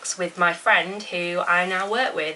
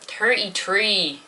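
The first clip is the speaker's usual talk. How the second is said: Irish accent; 3 semitones higher, much slower than usual. Shown as a demonstration. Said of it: "what those accents are".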